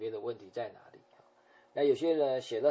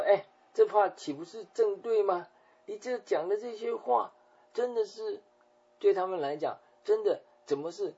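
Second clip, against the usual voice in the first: high, loud, outraged challenge; indignant accusation; 7 semitones higher, 5 dB louder than usual. Acted. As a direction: hurl the accusation back in disbelief.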